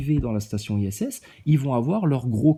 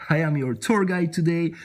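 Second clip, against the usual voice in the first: theme park guide; 2 semitones higher than usual. Retold or imitated. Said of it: "C'est une attraction Walt Disney".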